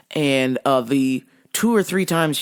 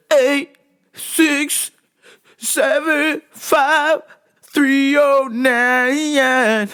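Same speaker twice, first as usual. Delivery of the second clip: high mock voice reading wrong numbers; imitating customer; 7 semitones higher, 3 dB louder, much slower than usual. Comedian mimics caller reading back wrong numbers, high mock voice. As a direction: rattle them off like the confused caller. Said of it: "She starts shouting out all the wrong numbers".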